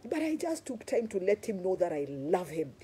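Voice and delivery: normal talking voice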